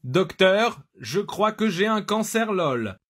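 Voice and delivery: voix synthétique plus aiguë; robot qui joue le patient. Shown as a demonstration. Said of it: "faire des voix de robots". (voix de robot)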